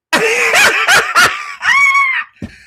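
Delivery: loud, very high squealing laugh; high shrieking laugh. Real, not acted. Laughing that she couldn't hook up the mic, high shrieking laugh. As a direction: crack up at her own failure.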